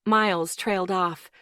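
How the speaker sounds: ordinary delivery, nothing marked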